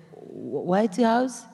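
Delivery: stammered, rising mock disbelief. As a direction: replay a stunned, halting question. Retold reaction to surprise call.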